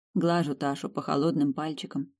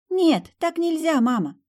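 put-on higher small girl's voice; little girl; 12 semitones higher, 4 dB louder than usual. A narrator's reading. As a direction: speak as a gentle little daughter. Daughter refuses.

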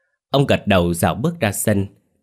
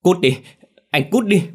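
much higher, louder frantic yell; yelling in terror; 11 semitones higher, 5 dB louder than usual. Acted. Boy screaming at the ghost Tèo to get away, frantic yell. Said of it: "la lối".

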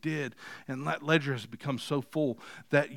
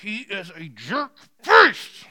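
mock yelling; 5 semitones higher, 9 dB louder, much slower than usual. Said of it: "when you're yelling as you write".

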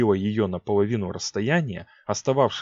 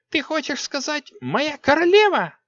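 put-on very high haughty girl; proud spoiled young queen; 5 dB louder than usual. A narrator's reading.

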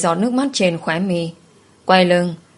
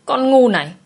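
sharp, higher angry jab; angry insult; 6 semitones higher than usual. Acted. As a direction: spit out the insult. Servant insults Trâm. (giận dữ quát lên)

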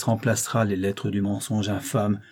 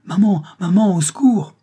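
put-on high, loud frightened child's cry; panicked child calling for mother; children's cries for help; 9 semitones higher, 6 dB louder than usual. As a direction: scream for help like a terrified child. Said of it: "des cris d'enfants emplirent d'effroi".

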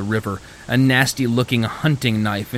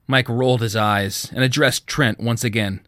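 ordinary delivery

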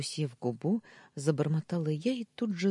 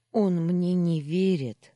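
loud, slow, higher acted lament; sobbing lament; 4 semitones higher, 7 dB louder, slower than usual. A narrator's reading. Wife shares her pain with the maid, tearfully. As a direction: wail it lightly, drawing out the words. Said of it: "всхлипнула я".

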